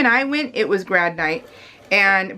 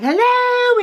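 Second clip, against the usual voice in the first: high, loud drawn-out hello; exaggerated blaring phone greeting; mock loud phone talker; 5 dB louder than usual. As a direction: imitate an oblivious person yelling into a phone. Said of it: "talk really loudly".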